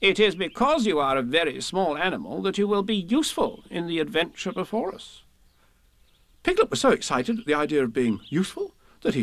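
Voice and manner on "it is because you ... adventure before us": high, busy Rabbit reassuring; Rabbit, encouraging. A narrator's reading. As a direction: Rabbit winning Piglet over. Rabbit voiced.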